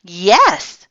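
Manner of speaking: a light, happy reply; mild shout. A narrator's reading. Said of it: "shouted the children".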